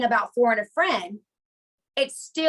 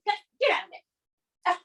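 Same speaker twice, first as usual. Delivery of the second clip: high, slowed scolding at a dog; scolding the puppy; 8 semitones higher than usual. Real, not acted. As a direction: shoo the puppy away. Scolding new puppy chewing things.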